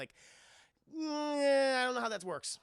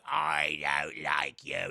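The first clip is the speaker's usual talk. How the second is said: low, slow, loud ghost line; old ghost grumbling hostility; ghost growl; 5 semitones lower, 5 dB louder, much slower than usual. Retold or imitated. Ghost Bill voice.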